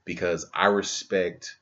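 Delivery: ordinary delivery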